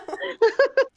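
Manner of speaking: high, loud burst of laughing; real laughter. Real, not acted. Player laughing at own formatting slip, real laughter. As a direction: crack up at the mistake. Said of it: "I messed up my formatting".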